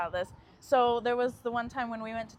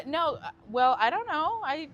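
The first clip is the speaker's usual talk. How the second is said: usual speaking voice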